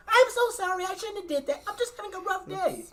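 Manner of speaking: high, soft mock-meek apology; sheepish apology. Coworker apologizing to the cashier, high soft meek voice. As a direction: whimper it like a sorry kid.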